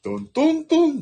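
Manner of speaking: トントントン on a high tune; トントントン with the drama melody; corrected intonation. Shown as a demonstration. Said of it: "って言わなきゃね".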